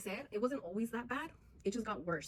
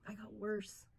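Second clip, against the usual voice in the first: whispered admission; 5 dB quieter, slower than usual. Real, not acted. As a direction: whisper it, heavy. Admitting she got worse.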